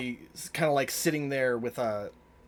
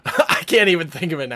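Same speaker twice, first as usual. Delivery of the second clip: loud, high burst through laughter; laughing; 6 semitones higher, 14 dB louder than usual. Real, not acted. Game master losing track of the accent, laughing. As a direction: crack up while admitting it. Cracking up over lost accent.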